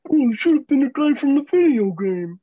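loud, put-on complainer voice; mock them in a silly voice, hitting each beat; mocking whine. Podcaster mimicking idiot fans, loud mocking whine.

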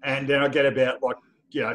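usual speaking voice

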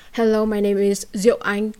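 ordinary delivery

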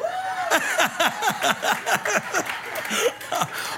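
high, ringing real laugh; hearty burst of laughter; big laugh. Real, not acted. Pastor laughing at own joke.

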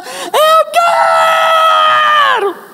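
long, very high drawn-out scream; hammed-up child wailing I want it; child's tantrum scream. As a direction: stretch it out like a screaming toddler.

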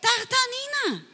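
high, slow, sing-song answer; storyteller answering her own question to the kids; eager answer. Acted. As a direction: answer the quiz for the children. Answer to quiz for children.

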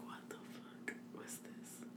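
slow, barely audible whisper; hushed imitation of a baffled reaction; whispered quote. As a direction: whisper it in mock disbelief. Quotes their reaction on listening back.

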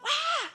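a light high yelp; a little startled squeak; small mock yelp. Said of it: "usually I'm just like".

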